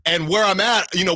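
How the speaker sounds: usual speaking voice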